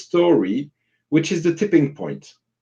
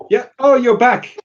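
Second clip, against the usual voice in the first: happy surprise; 10 semitones higher, 6 dB louder than usual. Real, not acted. Host sees co-host return.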